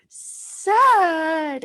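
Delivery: high, loud sad cry of a word; tearful answer. Retold or imitated. Crying woman answers her healer in a loud tearful burst. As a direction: blurt it out through tears.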